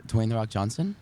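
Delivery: ordinary talk, nothing marked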